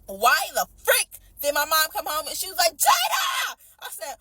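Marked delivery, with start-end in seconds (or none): 2.8-3.6 high yelled call of a name; mom's loud summons; mom yelling name. Retold or imitated. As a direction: call her name out sharply across the house. Mom calling her from home.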